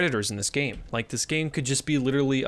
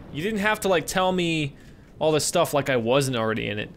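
ordinary talk

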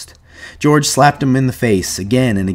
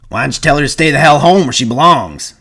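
higher, louder scolding; raised-voice rebuke; angry jeer; 6 semitones higher, 5 dB louder than usual. A narrator's reading. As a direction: tell him off with scorn. Carlson scolds Curly angrily.

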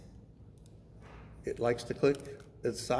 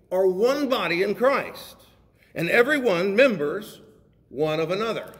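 loud, raised, measured preaching voice; scripture reading; 6 semitones higher, 6 dB louder than usual. Real, not acted. Preacher reading scripture to the congregation, ringing proclamation. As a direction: proclaim the verse phrase by phrase.

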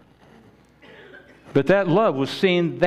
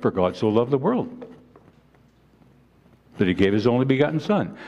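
fast, rushed recitation of a memorized verse; rattled off quickly; faster than usual. Shown as a demonstration. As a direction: say it quickly without thinking. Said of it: "We state it so quickly".

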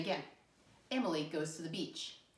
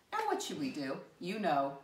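ordinary talk, nothing marked

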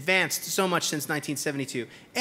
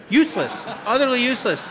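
loud, raised, driving declaration; emphatic outburst; 6 semitones higher, 7 dB louder than usual. Real, not acted. Speaker to audience, loud and raised. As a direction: hammer the point home.

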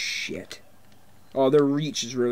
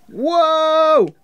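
loud surprised whoa; 13 semitones higher, 8 dB louder than usual. Real, not acted. Amazed at a secret.

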